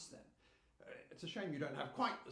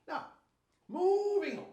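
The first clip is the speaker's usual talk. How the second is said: brisk moving on; 11 semitones higher, 8 dB louder than usual. Acted. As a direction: push the meeting on, loudly. Chair firmly closing off discussion.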